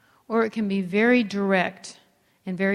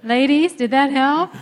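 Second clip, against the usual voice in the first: louder and higher, teasing the ladies; teasing call to the ladies; 5 semitones higher, 8 dB louder than usual. Real, not acted. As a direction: call out to the women in the room with mock disbelief. Calling out to the ladies.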